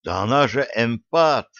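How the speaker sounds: ordinary delivery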